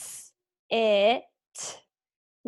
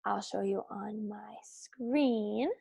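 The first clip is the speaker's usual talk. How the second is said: whisper; 3 semitones lower, 9 dB quieter, slower than usual. Acted. Teacher's aside to the class.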